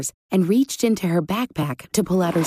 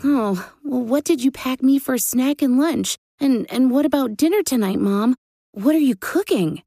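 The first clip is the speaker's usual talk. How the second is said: put-on higher-pitched little boy voice; hungry child asking; 7 semitones higher than usual. A narrator's reading. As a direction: pester Mom with eager questions. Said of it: "asked Gabe, thinking of nothing but the grumbling".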